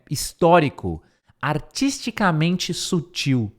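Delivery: ordinary delivery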